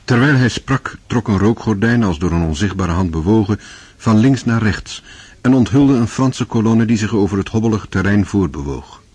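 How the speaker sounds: usual speaking voice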